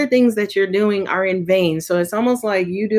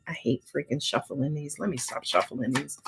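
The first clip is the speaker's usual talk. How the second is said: much softer, lower, under her breath; muttered aside; 4 semitones lower, 12 dB quieter than usual. Real, not acted. Tarot reader grumbling to herself about shuffling cards, muttered quietly. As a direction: mutter it to yourself off-mic.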